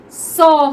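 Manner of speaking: सौ with a long open aw vowel, said loud; long aw sound. Shown as a demonstration. Teacher modelling the vowel for students, loud and long. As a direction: stretch out the aw in सौ. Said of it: "किसका sound आ रहा है".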